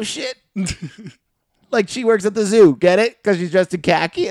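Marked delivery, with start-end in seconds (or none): 1.7-4.3 loud insistent explaining. Real, not acted. Explaining his bombed joke.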